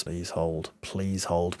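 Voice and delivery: low, soft praying plea; quiet plea. Real, not acted. Fan softly begging his team to hold on. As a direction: pray it under your breath.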